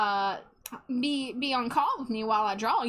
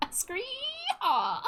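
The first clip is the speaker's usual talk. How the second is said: high mock cowboy whoop through laughter; laughing whoop; 13 semitones higher than usual. Streamer laughs through a mock Southern cowboy whoop. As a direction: crack up mid-yeehaw.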